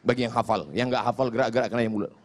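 ordinary delivery

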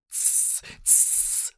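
sharp unvoiced shh; hissing shush. A narrator's reading. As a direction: hiss for silence. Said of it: "зашипел Голлум".

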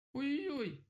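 very high pained yelp; wailing "oy-oy-oy". A narrator's reading. Rabbit hit in the eye by a cone, high wail. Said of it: "запричитал кролик".

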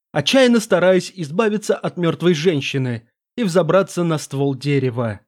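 ordinary delivery

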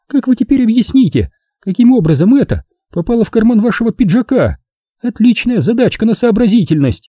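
high-pitched cold sarcasm; icy. A narrator's reading. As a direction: pose the challenge with feminine disdain. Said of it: "ледяным голосом произнесла она". (ледяным женским голосом)

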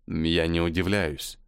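ordinary delivery, nothing marked

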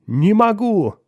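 high, loud cracking cry; breaking voice. A narrator's reading. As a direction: let the husband's voice break as he cries out. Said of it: "срывающимся голосом произнёс её муж". (срывающимся голосом)

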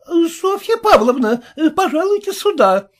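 put-on high calling voice of the old nanny. A narrator's reading. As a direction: call the lady from the next room. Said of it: "позвала нянька".

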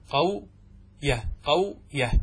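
"قوية" broken slowly as qaw... yah; two separated syllables in "قوية", qaw-yah; demonstrated sukun on waw. Shown as a demonstration. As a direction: hold the w of "قوية", then add yah. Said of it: "هكذا".

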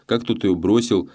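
ordinary delivery

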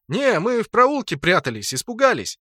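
high, timid-sounding excuse; feigned innocence. A narrator's reading. Narrator tells guard they hid scared, feigned innocence.

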